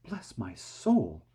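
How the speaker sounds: slow, amazed exclamation; astonished cry. A narrator's reading. Fire chief stares at the boy, amazed and slow. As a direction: blurt it out in disbelief. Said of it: "cried the head of the fire department".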